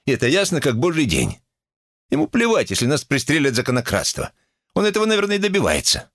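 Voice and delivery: high, exasperated complaint; indignant. A narrator's reading. Acted quarrel, complaint about the boy. (возмущённо, на повышенных тонах)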